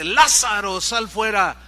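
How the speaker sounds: llamada fuerte y firme; ordénalo con autoridad a la tumba; voz de autoridad. Acted. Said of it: "con su voz de autoridad". (Jesús manda a Lázaro salir, con voz de autoridad fuerte)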